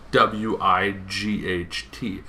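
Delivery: ordinary talk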